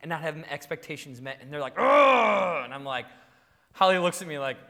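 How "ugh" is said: loud, frustrated "ugh"; mimicked kid's groan of anger; exasperated, drawn-out outburst; angry child's growl. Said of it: "makes my children behave angrily".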